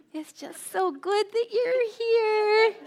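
very high, slow, drawn-out cooing; gushing welcome. Shown as a demonstration. Showing the audience what their smile should say, gushing welcome. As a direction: coo a silent-smile message aloud, high and stretched. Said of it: "I want you to communicate to them".

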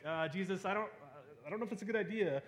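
high-pitched, halting reluctance; awkward hesitation. Acted. Martha to Jesus about opening the tomb, hesitant. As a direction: play a squeamish doubter voicing polite objection.

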